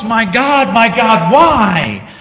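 loud, high cry of my God; anguished cry from the cross. Acted. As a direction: call out in agony from the cross. Voicing Jesus on the cross.

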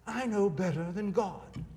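smug British accent mocking a critic. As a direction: lampoon Dawkins in a posh British accent. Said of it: "in his British accent".

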